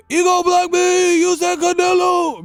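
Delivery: very high mock shriek; exaggerated high-pitched shouting; mocking viewer outburst. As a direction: yell it like a hysterical complainer. Mocks complaining viewers.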